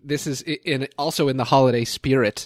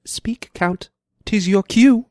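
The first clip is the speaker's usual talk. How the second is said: raised, pointed stage prompt; Shakespearean lady; 7 semitones higher, 4 dB louder, much slower than usual. Acted. Prompting the silent Count to speak, theatrical. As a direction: cue him in a high theatrical voice. Performs Beatrice in scene excerpt.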